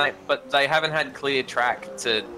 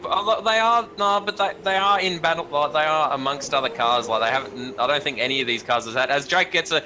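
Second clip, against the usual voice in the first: motorsport commentator; 6 semitones higher than usual. Real, not acted. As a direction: lively race call, a bit higher. Live play-by-play.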